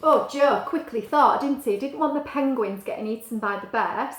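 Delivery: ordinary delivery, nothing marked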